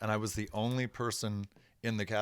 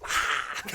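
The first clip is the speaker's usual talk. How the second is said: sharp voiceless gasp of horror; imitated fright gasp; 4 dB louder than usual. Two interns scared by the musician, startled gasp.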